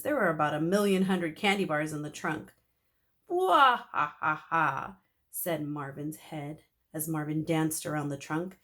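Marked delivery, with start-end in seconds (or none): 3.3-5.0 slow, loud villain cackle; spooky monster laughter; evil laugh. Acted. Mummy's head laughing evilly.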